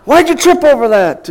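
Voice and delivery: high-pitched, full-volume mock scolding; yelling dad. Dad to his injured son who tripped, yelling. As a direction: imitate a father chewing out his kid loudly. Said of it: "he yelled".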